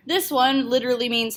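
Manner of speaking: ordinary talk, nothing marked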